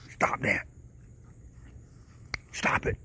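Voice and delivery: hushed whisper telling the raccoons off; whispered scolding. Real, not acted. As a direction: whisper it so the dog won't hear. I don't wanna talk too loud.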